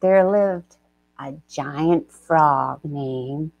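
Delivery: slow, hushed storyteller voice; epic fantasy narrator. A narrator's reading. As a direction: set the scene like an ancient legend. Storyteller sets the scene.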